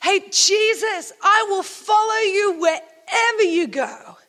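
high, slow, drawn-out shout; eager yell. Acted. Man in the crowd pledging to follow Jesus, eager yell. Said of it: "one guy yells out".

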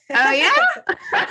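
high, laughing mock-innocent "oh yeah"; stifled laugh. Stepmom hiding dismay from stepson, laughing. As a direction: swallow a laugh behind a sweet question. Heart sank, forced calm reply.

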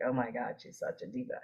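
quiet, under-the-breath reaction to a diva; half-voiced aside, imitating an intimidated bystander; hushed mock dismay. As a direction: imitate a dismayed onlooker, dropping right down in volume. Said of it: "look at them like".

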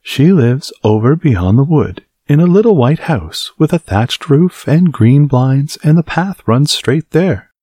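put-on high, bright little girl; chirpy innocent child. Girl answers the wolf.

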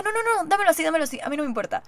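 excited pleading no no no; eager refusal. Retold or imitated. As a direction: grab it before he takes it back. Insisting on taking the cup.

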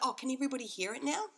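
high, quick, eager question; excited child. Retold or imitated. Son asks mum if the podcast is out, excited. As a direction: rush it out like a keen kid. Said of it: "he was so excited".

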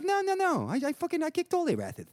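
high, lisping imitation, casually insistent; lisping Patrick. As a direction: mimic a mellow friend brushing off doubt. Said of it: "hear Patrick say that".

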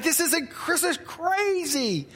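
high, giddy disbelief; high, laughing amazement; amazed kid. Retold or imitated. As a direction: relive a kid's overexcitement. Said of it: "I'm like".

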